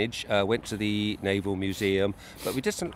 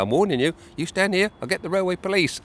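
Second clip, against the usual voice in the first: mock threat, pitched up high; threatening inspector; 10 semitones higher than usual. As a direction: play the petty official making threats. Inspector threatens railway police.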